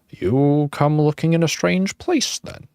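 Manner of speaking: a hint of a frail old ghost; old man.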